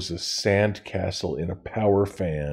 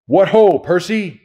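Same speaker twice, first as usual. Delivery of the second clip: much higher, loud hearty call; shouted; 9 semitones higher, 7 dB louder than usual. A narrator's reading. Mr. Brunner calling to Percy, loud hearty shout. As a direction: shout it across the room. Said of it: "he shouted".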